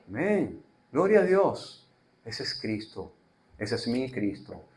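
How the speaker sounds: ordinary delivery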